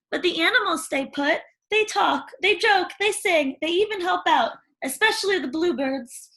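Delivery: put-on high, bright chatterbox girl; gushing young girl, rising as she goes; eager Cindy telling about animals. Cindy voiced in story.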